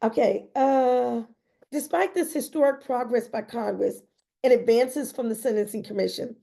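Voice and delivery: normal talking voice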